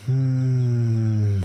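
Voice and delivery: long, steady hum; humming exhale. Shown as a demonstration. Demonstrating the exhale for listeners, long hum. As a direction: hum the breath out slowly.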